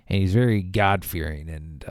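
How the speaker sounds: normal talking voice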